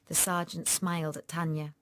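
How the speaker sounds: ordinary delivery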